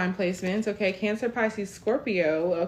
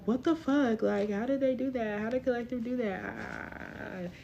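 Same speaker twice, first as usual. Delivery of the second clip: higher, hushed mock disbelief; imitating baffled onlookers; 5 semitones higher, 7 dB quieter, slower than usual. Reader voicing people reacting to your success, hushed mock disbelief. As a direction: play stunned onlookers, higher and softer, dragging out the end. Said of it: "people are gonna be like".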